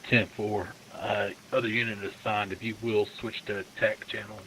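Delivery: police radio. Real, not acted. Unit answering over police radio.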